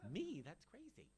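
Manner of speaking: normal talking voice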